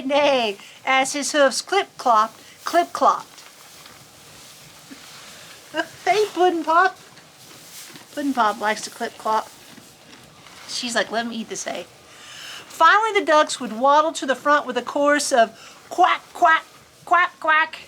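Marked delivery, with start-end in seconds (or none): none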